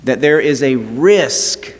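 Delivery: rising preacher's cadence with long pauses; a pastor turning a wedding story to Jesus, preaching with building fervour. Acted. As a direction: preach it, lifting the congregation.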